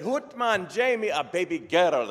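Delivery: high, booming Scottish brogue; Scottish doctor's announcement. Acted. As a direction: call out the birth in broad Scots. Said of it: "And the doctor said".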